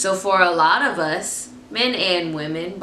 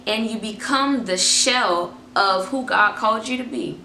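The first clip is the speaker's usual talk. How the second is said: ordinary talk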